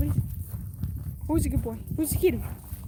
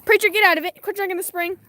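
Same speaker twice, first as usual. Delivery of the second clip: scolding call; 6 semitones higher, 7 dB louder than usual. Real, not acted. Scolding the dog.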